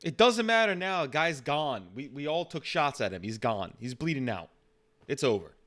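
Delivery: agitated cop shutting down the argument; blunt police officer. Acted. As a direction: start high and loud, then settle into finality. Plays the officer.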